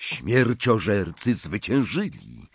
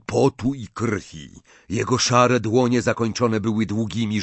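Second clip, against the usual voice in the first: plain reading voice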